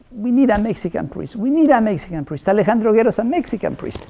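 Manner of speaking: high, pleading chant of a demand, said over and over; mock insistent demand. Parishioners in Portland clamoring to the bishop, high insistent chant. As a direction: mimic the parishioners begging for their own kind of priest. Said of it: "there was this community".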